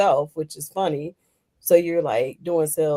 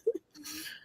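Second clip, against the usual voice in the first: high, drawn-out laughing reaction; laughing hard; 9 semitones higher, 6 dB louder, much slower than usual. Real, not acted. Host reacting to the comedian's accent story, helpless laughter. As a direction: squeeze the words out slowly through laughter.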